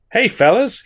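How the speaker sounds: high, loud call over a crowd; shouting. A narrator's reading. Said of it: "he shouted above the din".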